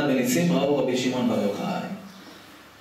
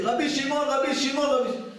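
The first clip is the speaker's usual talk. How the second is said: mock yell; 11 semitones higher than usual. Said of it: "והוא צועקת".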